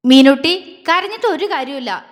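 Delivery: loud stern scolding of his sister; stern call. Acted. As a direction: cut through her tears firmly. Agni sternly demands an answer.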